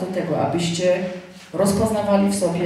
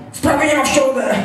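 higher, brusque mock official; abruptly changed tone; 6 semitones higher, 4 dB louder than usual. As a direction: imitate the caller suddenly dropping the pleasantries. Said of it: "do mnie powiedział innym tonem".

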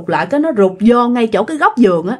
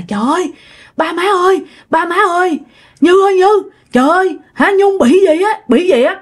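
higher, urgent call to the family; retold cry of alarm, pitched up; 7 semitones higher than usual. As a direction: call out for help in a mild panic. Retold husband calling parents in alarm.

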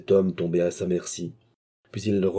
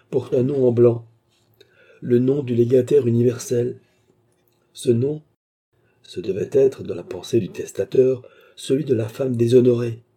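higher, louder, building to a question; scheming baronet; 4 semitones higher, 5 dB louder than usual. A narrator's reading. Baronet explains the blank will to the clerk, higher and louder. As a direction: lay out the scheme.

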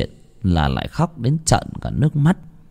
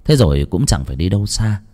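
plain reading voice

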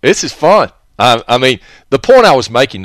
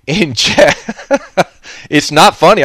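laughing; 3 semitones higher, slower than usual. Real, not acted. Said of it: "I don't know why I'm laughing".

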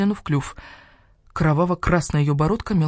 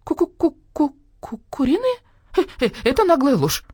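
clucking stammer; 11 semitones higher, 3 dB louder than usual. Acted. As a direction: cluck out the word in shock. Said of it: "с трудом выговорила". (кудахтая, с трудом)

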